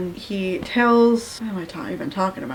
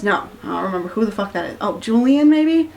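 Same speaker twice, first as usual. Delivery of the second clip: ordinary talk, nothing marked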